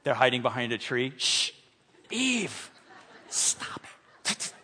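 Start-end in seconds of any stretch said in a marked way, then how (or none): 1.2-4.5 hushed unvoiced whisper to Eve. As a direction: hiss it from behind the tree. Adam hiding whispering to Eve.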